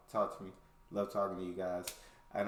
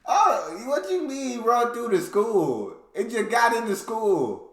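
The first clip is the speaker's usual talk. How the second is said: loud, high, drawn-out disbelief; incredulous outburst; 11 semitones higher, 9 dB louder, slower than usual. Real, not acted. Reactor hearing kangaroos ran through school, loud incredulous outburst. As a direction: holler it slowly, high, in astonishment.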